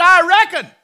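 loud, high "I reckon" in a Southern drawl; Southern accent, shouted with certainty. Acted. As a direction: proclaim it like a Southern boy. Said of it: "He's a Southern boy".

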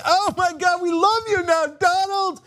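high gushing fan voice. Retold or imitated. Host mocking imagined adoring crowds, high gushing. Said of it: "everybody would be like".

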